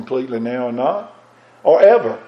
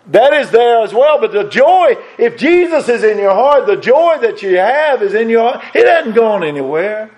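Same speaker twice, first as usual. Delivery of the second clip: full-force preaching; 8 semitones higher than usual. Acted. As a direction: preach it out with mounting fervour. Preaching builds to climax.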